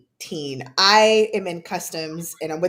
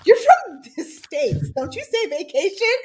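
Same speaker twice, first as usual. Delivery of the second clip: customs agent, amused; 10 semitones higher, 5 dB louder than usual. Retold or imitated. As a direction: tease her like a grinning customs officer. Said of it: "he went".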